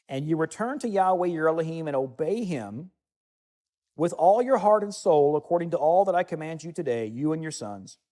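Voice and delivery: solemn bible reading from the pulpit; scripture reading. Acted. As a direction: proclaim the verses to the congregation. Reads Deuteronomy thirty aloud.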